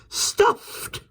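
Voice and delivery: breathless horrified whisper; gasping whisper. A narrator's reading. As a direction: gasp the word in shock. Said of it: "gave a little gasp".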